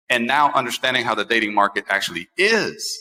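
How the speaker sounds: ordinary delivery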